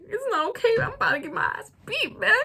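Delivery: high-pitched, tearful whine; mock wailing. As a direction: squeal it out like a hammed-up sob. Wailing reply to the police officers.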